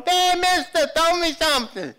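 high, loud holler for throws; Mardi Gras shout. Acted. Parade-goer begging at Mardi Gras, hollering. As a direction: holler up to a passing float. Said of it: "It's like Mardi Gras".

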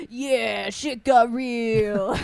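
drawn-out, hammed-up cheer; slow, savoring guilty-pleasure hype; mock hyped reaction. Host confessing love of a trope, hammed-up excitement. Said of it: "I'm like".

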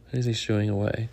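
plain reading voice

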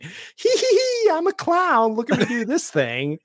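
high giggly goofy clown; silly clown voice. Retold or imitated. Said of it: "Now it's just like".

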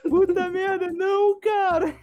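high-pitched disbelief; espantado. Real, not acted. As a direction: squeal in shocked disbelief. Reação ao jingle. (espanto agudo)

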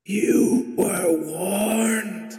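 slow, high, otherworldly warning; drawn-out ghostly voice; ethereal voice. Acted. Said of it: "He's gonna talk in an ethereal voice".

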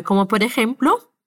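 ordinary delivery

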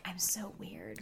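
whispered mock confession; mock self-conscious. Mocking being that girl, a mock whisper. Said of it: "being that girl that's like".

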